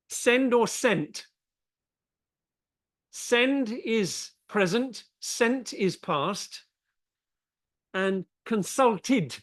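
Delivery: slow down and sound out send and consulted; slow model words. Shown as a demonstration. Teacher modelling the words for a student. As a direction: send or sent said slowly and clearly. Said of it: "is the way you say it".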